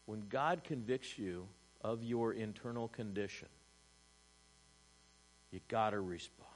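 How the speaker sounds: usual speaking voice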